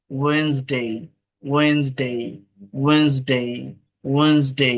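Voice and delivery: "ওয়েডনেসডে" as two-syllable wenz-dei, silent d; correct Wednesday. Shown as a demonstration. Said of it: "ওয়েডনেসডে এরকম না".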